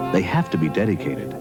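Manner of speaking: ordinary delivery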